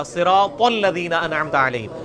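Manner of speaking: ordinary talk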